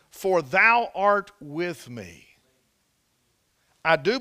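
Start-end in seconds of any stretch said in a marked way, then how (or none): 0.1-2.3 "thou" stretched, each word drawn out slowly; preacher hammers home the key verse, slow and deliberate; slow emphatic verse. Acted. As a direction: lean into "For thou art with me" word by word.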